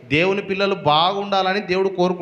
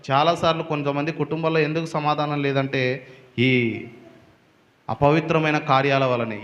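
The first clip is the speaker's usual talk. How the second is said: normal talking voice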